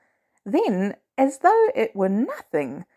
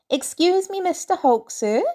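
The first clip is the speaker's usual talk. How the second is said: put-on polite kid voice; 8 semitones higher than usual. Kid politely addressing the Hulk.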